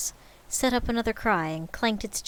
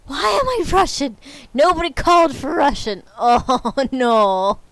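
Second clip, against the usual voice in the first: laughing exasperation; 5 semitones higher, 9 dB louder than usual. Real, not acted. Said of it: "laughing despair at herself".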